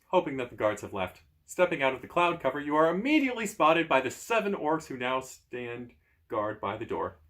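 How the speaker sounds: ordinary delivery, nothing marked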